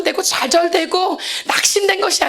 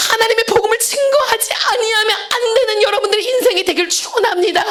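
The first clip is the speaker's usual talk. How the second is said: ordinary delivery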